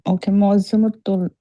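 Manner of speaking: normal talking voice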